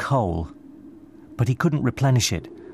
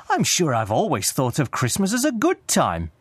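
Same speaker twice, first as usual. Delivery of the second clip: lightly voiced, higher-pitched earnest nephew; earnest cheerful nephew; 6 semitones higher than usual. A narrator's reading. Nephew defends Christmas to Scrooge, earnest. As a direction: gently insist with rising enthusiasm.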